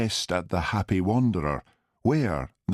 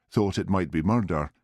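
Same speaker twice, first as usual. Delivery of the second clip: usual speaking voice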